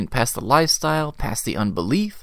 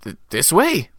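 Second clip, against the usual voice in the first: nervous stammer, stuck on the first sound; a mild stammer from a startled little man; 4 semitones higher than usual. A narrator's reading. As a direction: trip over the start, then push it out. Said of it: "Zacchaeus stammered".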